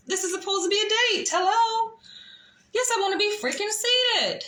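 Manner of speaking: imitating her own exasperated comeback to the waiter; exasperated retort. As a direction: let the waiter have it, incredulous and sarcastic.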